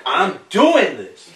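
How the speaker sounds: slow, emphatic show of energy; energetic demo. Shown as a demonstration. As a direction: show how to sell it. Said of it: "you have to come with that energy".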